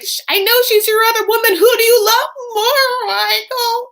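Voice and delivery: very high, loud mock screech; hammed-up jealous shriek, stretching the question; very upset. Wife asking Michael to choose, hammed-up upset screech. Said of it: "like, very upset".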